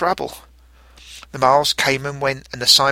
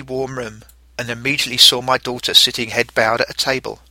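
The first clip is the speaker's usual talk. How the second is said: ordinary delivery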